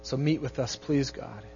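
soft, hushed prayer to God. Real, not acted. Pastor praying over his congregation, soft and earnest. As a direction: pray quietly with the congregation, gently.

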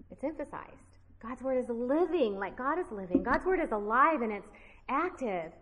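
ordinary delivery

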